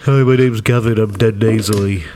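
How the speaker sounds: mocking, nasal put-on voice of a friend; nasal Gavin impression. Host mocking his co-host's voice, nasal impression. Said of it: "No, it's more like this".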